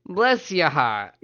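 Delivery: high, loud, slow drawl; pointed politeness. Acted. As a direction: draw it out as a pointed put-down. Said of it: "She hit you with the".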